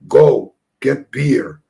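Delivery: slow, loud, booming command; giant's gruff demand. Retold or imitated. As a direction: boom it out slowly like a giant. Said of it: "Andre the Giant says".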